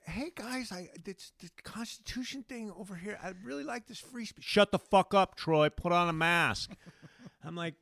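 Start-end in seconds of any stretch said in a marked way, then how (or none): 0.0-4.4 higher, softer, sweetly naive; mock innocent self. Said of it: "I'm just like". 4.4-6.7 louder snapped mock retort; mock angry critic. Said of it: "angry critics reply".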